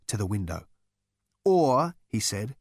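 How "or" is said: sudden alarm. Acted. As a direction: catch it in alarm. Building shaken, alarmed.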